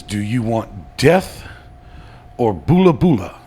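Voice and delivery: slow, loud menacing offer; cannibal chief voice. Acted. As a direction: loom over them with the choice.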